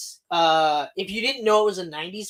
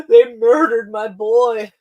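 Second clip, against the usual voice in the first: high-pitched, hammed-up sobbing wail; loud, drawn-out fake bawling; mock crying; 5 semitones higher, 6 dB louder, slower than usual. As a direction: wail in mock grief over a lost boy. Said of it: "walking out of the theater crying".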